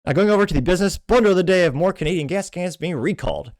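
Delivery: high, loud show-host announcement; segment announcer. Real, not acted. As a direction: announce the next segment with punch.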